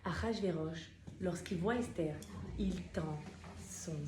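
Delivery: ordinary talk, nothing marked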